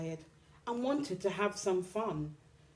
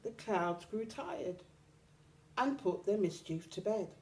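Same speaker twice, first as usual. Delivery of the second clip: ordinary delivery, nothing marked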